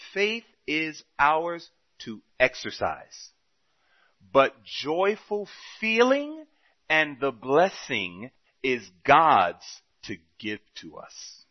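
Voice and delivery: slow, deliberate scripture reading; solemn reading. Acted. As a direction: read each word out slowly.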